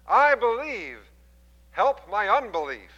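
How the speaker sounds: high, loud, slow plea; a desperate father crying out to Jesus. Acted. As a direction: cry it out desperately. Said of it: "cried out and said".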